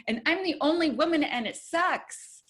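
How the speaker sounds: quick high complaint, slowing at the end; mock complaint. Telling the guys she's the only woman, mock complaint. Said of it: "And I'm like".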